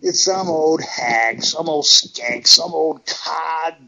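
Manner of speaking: slow, contemptuous drawn-out insults; scornful insults. Retold or imitated. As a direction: spit out each insult with disdain. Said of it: "I'm thinking".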